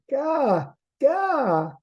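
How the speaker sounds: put-on high babbling baby cry; baby Raven voice. A narrator's reading. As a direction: squeal it like a demanding baby. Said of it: "He began to cry".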